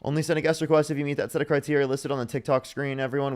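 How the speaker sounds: ordinary talk, nothing marked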